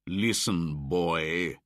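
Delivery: loud, bossy hunter; loud threatening hunter; shouted. A narrator's reading. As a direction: bark at the boy. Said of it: "shouted Buldeo".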